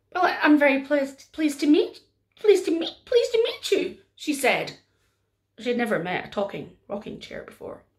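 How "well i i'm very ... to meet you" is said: flustered stammer. Acted.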